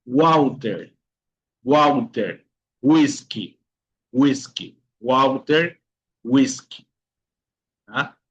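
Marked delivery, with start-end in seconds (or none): 0.1-6.9 "Walter" as "uauter", "whisky" as "uiski". Shown as a demonstration. Teacher giving examples of W, "Walter, whisky" with U sound. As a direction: start "Walter" and "whisky" on an U glide. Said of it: "la vocal U".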